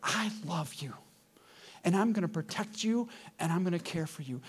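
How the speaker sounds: soft, unhurried parental comfort; tender reassurance. Acted. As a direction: reassure gently, with space between phrases. God tenderly reassures the fallen couple.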